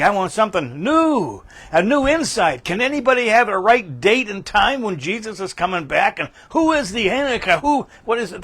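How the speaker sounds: high-pitched imitation of excitable seekers; put-on excited questioning, high; mock eagerness. Mimics eager seekers of new prophecy.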